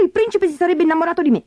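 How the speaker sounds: fast, very high peevish complaint; petulant, hurried grumbling; whining stepsister. A narrator's reading. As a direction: rattle off a shrewish high whine. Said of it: "si lagnava Berta".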